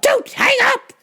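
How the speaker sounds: high-pitched, desperate mock yell; frantic plea. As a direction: cry out before the line goes dead. Plea to mom escalates.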